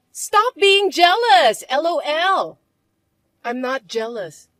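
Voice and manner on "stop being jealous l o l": slow, high, loud mockery; taunting stepsister. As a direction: drag out stop being jealous.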